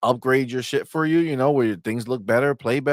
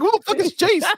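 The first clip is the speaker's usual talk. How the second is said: much higher, louder, cracking with laughter; laughing disbelief; 5 dB louder than usual. Retold or imitated. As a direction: squeal it through laughter. Laughing retort about son's show.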